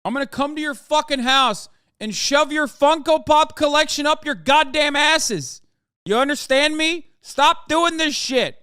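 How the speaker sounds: rising from loud anger to screaming rage; escalating yell at annoying viewers; furious rant. Real, not acted. Ranting at chat, escalating.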